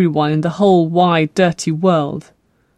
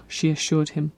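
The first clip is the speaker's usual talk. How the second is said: plain reading voice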